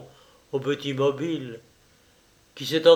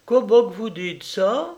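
shocked cry; 7 semitones higher, 4 dB louder than usual. Acted. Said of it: "cria la paysanne".

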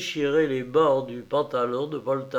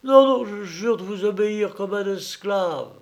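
high pleading, muffled behind a mask; 7 semitones higher, 4 dB louder than usual. Acted. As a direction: beg through a mask over the face. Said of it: "d'une voix étouffée par son masque".

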